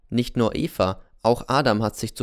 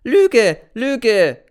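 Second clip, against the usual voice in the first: loud, much higher cry of outrage; shouting lie; 12 semitones higher, 10 dB louder than usual. Acted. Boy N screams back at Z's accusation, loud and high.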